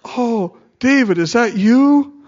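overacted "oh, is that you?"; mock innocent Saul. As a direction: play the fake-sweet king. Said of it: "he's playing this part".

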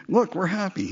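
high, strained show of happiness; forced cheer. Retold or imitated. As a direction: fake a bright smile in your voice. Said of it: "trying real hard".

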